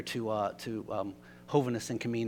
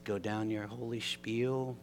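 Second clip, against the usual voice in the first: normal talking voice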